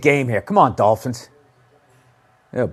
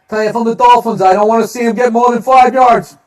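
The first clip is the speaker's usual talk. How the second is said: higher, louder fired-up call; 7 semitones higher, 7 dB louder than usual. Real, not acted. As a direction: fire up the fans. Pumped commentator take.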